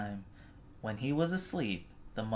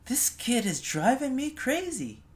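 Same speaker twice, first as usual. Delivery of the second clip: high, louder frazzled mom; exasperated mother; 12 semitones higher, 5 dB louder than usual. Retold or imitated. Mother fed up with her toddler, high frazzled exclamation. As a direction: throw up your hands at the toddler. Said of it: "his mother would say".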